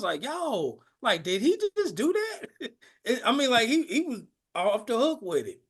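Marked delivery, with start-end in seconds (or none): 0.2-2.7 higher-pitched, laughing disbelief; amazed disbelief. Retold or imitated. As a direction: retell a wowed reaction, pitch jumping up. Recalls own amazed reaction to comedian.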